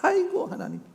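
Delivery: very high, mock-exasperated sigh; scoffing laughter of disbelief. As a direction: imitate Abraham brushing off God's promise. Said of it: "지금 웃는 거예요".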